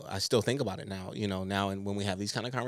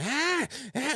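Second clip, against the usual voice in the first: put-on high whiny baby wail; imitating baby crying. Mother imitating her baby crying in his crib, high wail. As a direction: mimic a fussing newborn. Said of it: "he was like".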